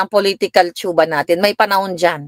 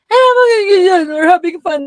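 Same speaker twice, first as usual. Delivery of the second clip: carefree daughter voice; 12 semitones higher, 4 dB louder than usual. Retold or imitated. Daughter's reply, retold.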